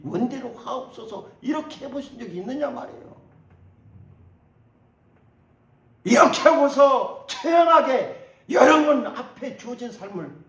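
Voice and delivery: very high, loud pleading preaching; fiery sermon with a mock desperate prayer; preaching at full force. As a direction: cry it out to the rafters. Preacher climaxes, then mimics prayer.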